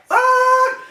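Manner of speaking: very high, held squeal; imitated pained cry. Shot man hurting from zip ties, pained cry. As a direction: hold a high cry of hurt.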